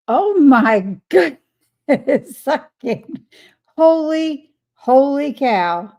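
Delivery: loud, slow, laughing astonishment; laughing disbelief. Real, not acted. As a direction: gasp it out, floored by the news. Shocked by a viewer's weight loss.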